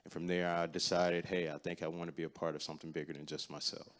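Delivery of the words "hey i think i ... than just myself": ordinary delivery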